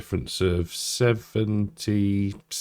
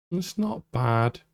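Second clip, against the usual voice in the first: brief mock Scottish accent, higher pitch; 6 semitones higher than usual. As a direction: slip into a playful Scottish accent. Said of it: "I went into a Scottish accent then".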